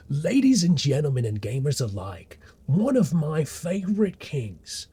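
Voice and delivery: high, loud, stretched ring-announcer call; hype announcer. Real, not acted. Host presents a favorite king to gamers, hype announcer. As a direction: announce them grandly, drawing out the words.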